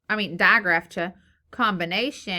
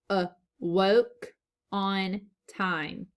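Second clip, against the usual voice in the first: awoke on time read very slowly; slow careful read-aloud; slow modelled reading; much slower than usual. Shown as a demonstration. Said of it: "let's read the sentence".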